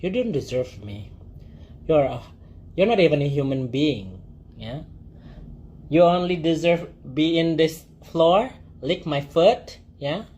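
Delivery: a mistress looking down on a client; dominatrix voice. Shown as a demonstration. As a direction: belittle him, slowly and icily. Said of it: "dominatrix tuh kalian harus ngomong".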